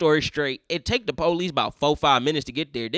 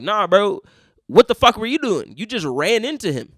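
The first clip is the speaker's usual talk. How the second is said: louder, higher mock confrontation; acted-out incredulous telling-off; retold indignant challenge; 5 semitones higher, 7 dB louder than usual. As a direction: call him out in disbelief, loudly. Cop retold confronting the other driver.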